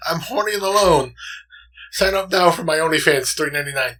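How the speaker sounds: high, loud mock advert; chat spammer. Reading the last live chat message, as a mock plug. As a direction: read it out like a shameless plug, starting high. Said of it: "But the very last one".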